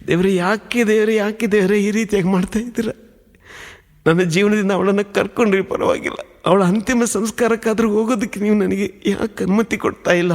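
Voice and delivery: recalled anguished plea to God, pitched high; retold tearful plea. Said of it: "ಮಲಗಿ ಅಳ್ತಾ ಇದ್ದೀನಿ".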